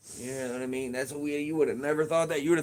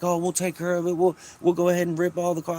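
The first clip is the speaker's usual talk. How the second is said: landlord quoted, higher and quick; imitating landlord; 6 semitones higher, 4 dB louder, faster than usual. Tenant mimicking the landlord's easy excuses, higher and quick. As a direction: brush it off like the landlord. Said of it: "He's like".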